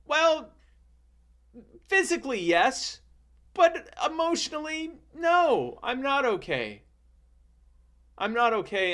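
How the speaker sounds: put-on high-pitched, halting little-child voice; hammed-up wounded kid; hurt child, acted. Acts hurt child's answer to rescuer.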